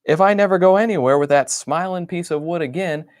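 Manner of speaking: a sarcastic, worn-out complaint; exasperated. Acted. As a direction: grumble it out in one exasperated rush. Grumbling diary entry.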